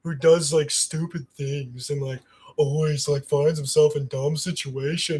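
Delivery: put-on dopey character voice; deep voice. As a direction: do a lightly voiced deep-voiced goofball. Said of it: "I wanted with this deep voice".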